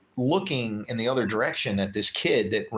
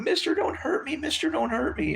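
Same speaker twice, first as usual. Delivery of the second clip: much higher, pleading mock kid voice; scared pleading kid. Kid who hit the biker begging not to be hurt, high and scared. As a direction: beg like a frightened teenager. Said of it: "he's going".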